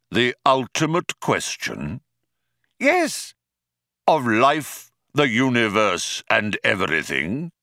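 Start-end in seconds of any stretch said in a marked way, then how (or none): 2.8-3.3 very high, exasperated yes; impatient. A narrator's reading. Loonquall confirms the question to computer, impatient. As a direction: squeal it out impatiently.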